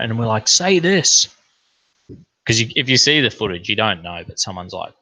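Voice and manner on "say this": high, loud prompt; calling out. Retold or imitated. Egging the politician on at the rally, high and loud. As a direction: egg him on, loud and high.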